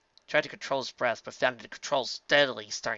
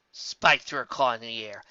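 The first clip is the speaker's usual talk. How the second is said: ordinary delivery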